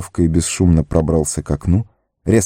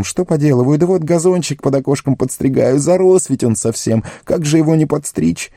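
high, innocent explaining voice; flustered gardener; 8 semitones higher than usual. A narrator's reading. Sam explains himself to the wizard, high and flustered. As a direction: pipe up with a high, anxious excuse.

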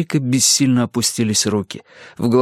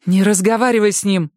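higher, louder curt order; sharp cut-off; 12 semitones higher, 4 dB louder than usual. A narrator's reading. Mother forbidding daughter to talk, sharp curt order. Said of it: "оборвала Джеки".

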